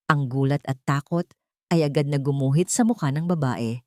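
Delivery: plain reading voice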